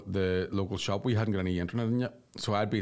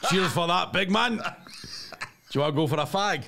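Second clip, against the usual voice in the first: mock Glaswegian woman; 8 semitones higher than usual. Imagined Glaswegian Japanese woman voiced.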